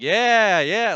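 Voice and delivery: keen, excited agreement; getting excited. Retold or imitated. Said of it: "I'm getting excited".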